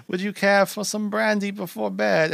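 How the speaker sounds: high-pitched imitation of a housekeeper; housekeeper offering drinks. Housekeeper offering the guest brandy, high mock formality. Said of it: "but she's like".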